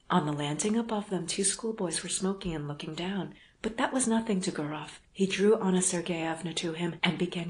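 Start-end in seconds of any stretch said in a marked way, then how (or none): none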